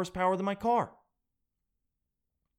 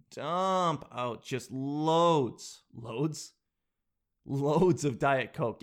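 drawn out; much slower than usual. Real, not acted. Exaggerating the dumping.

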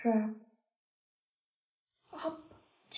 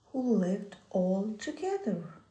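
usual speaking voice

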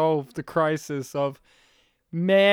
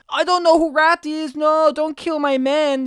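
higher, louder mock plea; pleading worker; 7 semitones higher, 5 dB louder than usual. Syrian worker pleading with the villain, mock desperate and higher. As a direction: beg like a scared worker, hammed-up.

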